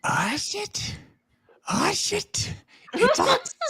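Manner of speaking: high, hushed bug voice; a startled little bug, panicking; panicked insect voice. Acted. Startled insect creature speaks.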